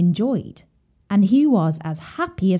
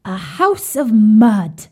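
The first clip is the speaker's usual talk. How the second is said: slow, louder little pig voice; a hint of a muddy little pig, drawn out; piglet Brownie; 2 semitones higher, 7 dB louder, slower than usual. A narrator's reading. Brownie answers.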